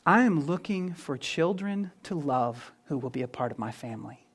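hushed, tender invitation; tender voice of God. Retold or imitated. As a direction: gently voice God calling his children. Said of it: "The message of this book is".

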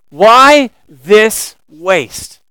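slow, loud drawn-out question; indignant. Retold or imitated. Preacher voicing the disciples, slow and outraged. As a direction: stretch every word, outraged. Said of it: "What kind of question is this".

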